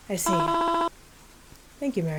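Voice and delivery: a frail mother lingering on every word; grieving mother voice. Acted. Mother character speaks.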